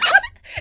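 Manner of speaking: loud, high laughing burst; burst of laughter. Real, not acted. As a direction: crack up out loud. Reacting to the caption joke.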